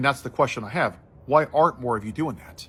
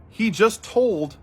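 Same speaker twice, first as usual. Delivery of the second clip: slow, high, louder stressed words; outraged emphasis; 9 semitones higher, 5 dB louder, much slower than usual. Retold or imitated. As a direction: drag out each word in disbelief. Family outraged at clerk.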